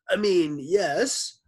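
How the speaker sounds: high, slow, loud correction; put-on stolen voice. A narrator's reading. Teacher clears his throat and retries, high and slow.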